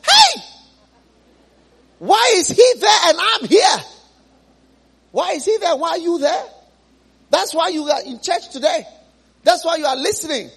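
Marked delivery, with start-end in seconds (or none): 0.1-6.4 high, loud desperate rich man calling up from hell; anguished cry. Acted. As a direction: rich man screaming across the gulf, then sinking.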